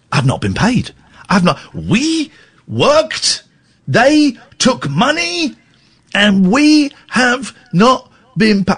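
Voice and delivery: slow, high mock union man; working class labour voice. To the club, a slow high working class grievance. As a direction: play the wronged worker demanding pay. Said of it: "I got proper working class labour".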